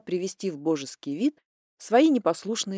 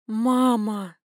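tragic heroine sorrow; 2 semitones higher, 3 dB louder than usual. Acted. Exasperated protest, heavy sigh.

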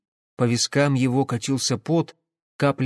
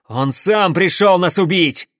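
loud, raised self-defence; shouted retort; 5 semitones higher, 7 dB louder than usual. A narrator's reading. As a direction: shout back against an accusation. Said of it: "крикнул Пахомов". (громкий оправдывающийся крик)

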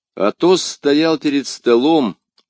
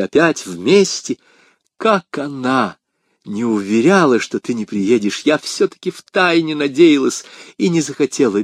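overjoyed; 4 semitones higher, 3 dB louder than usual. Acted. Woman reunited with her lover at last, overjoyed. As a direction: gush with happiness at being reunited.

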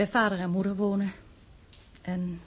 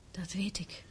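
soft whispered admission; whispered reply; 9 dB quieter than usual. Acted. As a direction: barely breathe the words. Mother admits painful fact.

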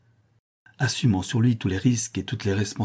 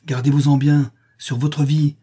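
high, loud, urgent warning; sharp retort; 5 semitones higher, 6 dB louder than usual. A narrator's reading. Harry warns Don Blas off the wagons, sharp and loud. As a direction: cut him off hard. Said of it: "riposte vivement Harry".

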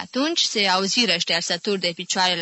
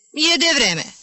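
a bit higher, pronounced as a verdict; decreeing; 7 semitones higher, 3 dB louder than usual. A narrator's reading. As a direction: declare it as final. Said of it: "decretând". (decretând sec)

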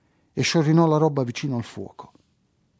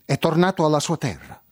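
higher, frightened voice; 5 semitones higher than usual. Acted. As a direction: say it scared and quick. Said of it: "disse spaventata".

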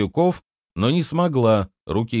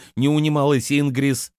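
ordinary delivery

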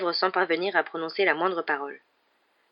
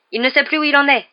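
high, loud, excited exclamation; crying out; 8 semitones higher, 7 dB louder than usual. A narrator's reading. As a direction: shout it out to urge the class on. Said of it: "s'écria Lupin". (exclamation forte, voix haute)

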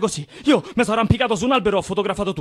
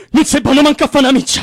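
angry outburst; 4 semitones higher, 7 dB louder than usual. Acted. Angry accusation in a quarrel.

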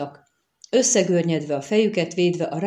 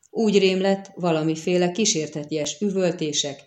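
ordinary delivery

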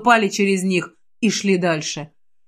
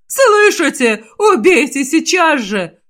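high, loud, slow, cracking; hysterical shriek; 6 semitones higher, 4 dB louder, slower than usual. Acted. Sabron keeps screeching his order at the troops. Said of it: "Его голос сорвался на визг".

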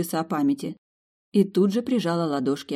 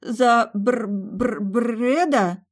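unsure stammer on Бр-; 7 semitones higher, 3 dB louder, much slower than usual. A narrator's reading. Friends unsure of the groom's name, stammering. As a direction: stammer it out nervously. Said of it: "заикаясь, неуверенно промямлили".

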